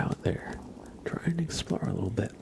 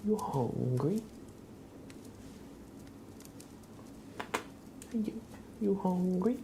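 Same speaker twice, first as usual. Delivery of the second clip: cat-teasing singsong; 11 semitones higher, 6 dB quieter than usual. Real, not acted. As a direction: stretch it out in a high singsong, swooping up at the end. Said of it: "I always say it like that".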